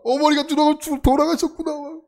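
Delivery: overplayed sobbing grief; mock wailing. Boy pretending his mother died, mock weeping lament. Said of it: "전 더 울어 막".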